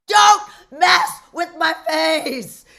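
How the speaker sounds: loud, slow, emphatic warning; high, punched-out demand; retold stern warning. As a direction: hit each word as a comic warning.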